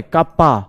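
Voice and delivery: कप्पा said as kap-pa, with no extra syllable in the middle; teacher correcting the word to कप्पा; correct pronunciation. Shown as a demonstration. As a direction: say कप्पा short, as two syllables, not कटप्पा. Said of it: "अबे कटप्पा नहीं बोलना है".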